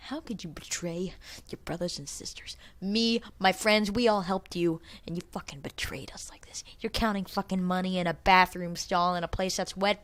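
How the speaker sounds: hissed, hurt whisper; betrayed whisper. Retold or imitated. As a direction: accuse him under your breath. Scolding the betrayer in hushed tones.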